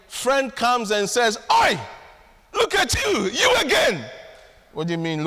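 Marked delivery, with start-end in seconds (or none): 1.5-4.0 mocking friend's shout. Friend's mocking greeting retold.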